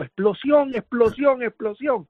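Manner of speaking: high-pitched repeated cry, not full volume; imitated alarm yell. Survivor imitating a man yelling down the hallway, high mock shout. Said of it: "se oye un hombre gritando".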